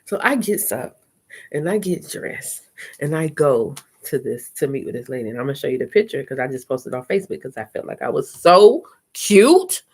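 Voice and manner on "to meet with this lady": ordinary delivery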